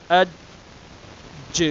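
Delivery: اج released with a small bounce on ج; qalqalah echo. Shown as a demonstration. Teacher showing the release, اج with an echo. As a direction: bounce the jeem of اج. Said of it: "ان کو پھر release کرنے کے لیے".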